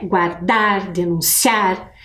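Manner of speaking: "guardar, denunciar, acabar" with the last syllable of each word leaned on; slow "denunciar", stress landing on "ciar". Shown as a demonstration. Teacher showing the class the strong syllable in each word. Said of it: "a sílaba mais forte é o ciar".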